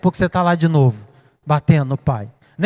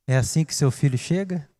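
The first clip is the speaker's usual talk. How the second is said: usual speaking voice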